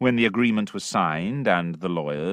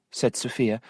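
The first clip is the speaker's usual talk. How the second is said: usual speaking voice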